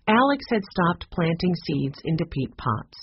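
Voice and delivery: usual speaking voice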